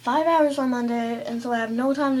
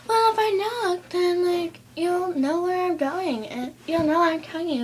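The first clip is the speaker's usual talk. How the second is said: higher, prissy parent excuse; mock mom voice; 6 semitones higher than usual. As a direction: imitate mom justifying herself, pitched up. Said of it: "She's like".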